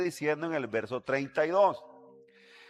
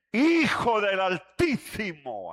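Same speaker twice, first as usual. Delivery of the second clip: proclaimed answer; 2 semitones higher than usual. Acted. Answering his own question.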